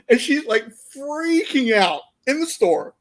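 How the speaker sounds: ordinary talk, nothing marked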